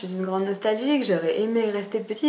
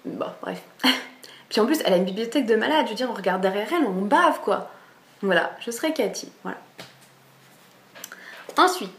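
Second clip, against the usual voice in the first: usual speaking voice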